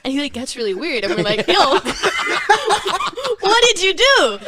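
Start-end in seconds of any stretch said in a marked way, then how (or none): 1.5-4.4 high, loud, laughing accusation; laughing teasing. Retold or imitated. Friends joking with Ilya about the helicopter, laughing teasing. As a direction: tease him through your laughter.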